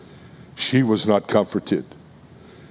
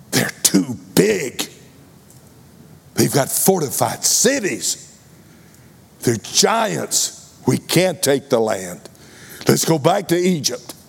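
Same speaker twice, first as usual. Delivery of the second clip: voicing the scared faithless spies, pitched up; fearful spies; 3 semitones higher than usual. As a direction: whine it like cowards who give up. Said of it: "who said".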